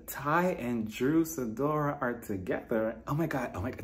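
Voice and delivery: quiet, halting off-camera voice; hushed confessional aside. Acted. Acts Drew pausing her confessional.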